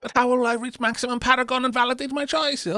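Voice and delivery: high, loud whiny mock complaint ending in a squeal; mock whine. Streamer mocking min-maxers, high whiny squeal. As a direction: parody a tryhard gamer.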